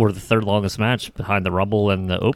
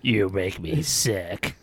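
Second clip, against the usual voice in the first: slow, softer mock growl; disgusted wrestler promo; 7 dB quieter, much slower than usual. Podcaster as the wrestler insulting his rival, slow mock disgust. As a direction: drag it out with hammed-up contempt.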